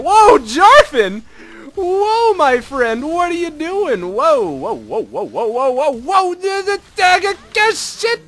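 real flustered alarm rising to a curse; frantic yelling. Real, not acted. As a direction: yell as the fight goes wrong. Caught by enemy Jarvan.

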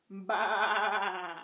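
bleating baa; sheep baa. Shown as a demonstration. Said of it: "Let's do their sounds".

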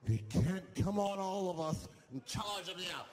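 ordinary delivery

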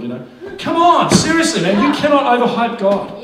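usual speaking voice

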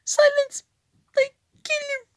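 very high, loud shouted villain line; mock card voice. Gamer mimicking a card's voice line, shouting. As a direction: bark it like a game character.

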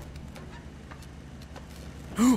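drawn-in gasp of shock; long frightened gasp. Real, not acted. Something jumps out.

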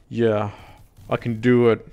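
usual speaking voice

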